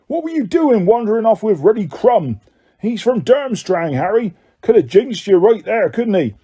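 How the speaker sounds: angry scolding. A narrator's reading. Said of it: "said angrily to Harry".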